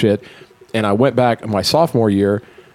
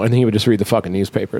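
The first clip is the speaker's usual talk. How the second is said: usual speaking voice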